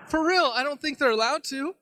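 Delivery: high-pitched insistence from the sideline; acted-out anxious bystander protest; earnest protest. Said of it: "sitting on the sideline going".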